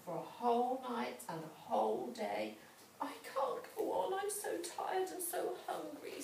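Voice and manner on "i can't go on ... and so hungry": tired sigh. A narrator's reading. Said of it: "sighed Gretel".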